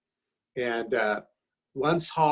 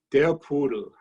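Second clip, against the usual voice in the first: Der Pudel said as "dare POO-dul" in a mock German accent, louder; 4 dB louder than usual. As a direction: Der Pudel with a rolled German r and a hammed-up German lilt. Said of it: "why the German accent?".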